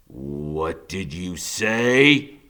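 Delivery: a lightly voiced killer turning slowly on the boy; menacing killer. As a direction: drag each word out like a threat. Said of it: "he says".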